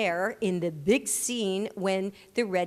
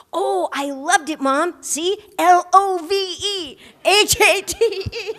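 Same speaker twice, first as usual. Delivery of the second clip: put-on loud, high, thrilled kid voice; excited child; 10 semitones higher, 10 dB louder than usual. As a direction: son gleefully shows off his new trick to mom, loud and high. Son excitedly quoting the movie.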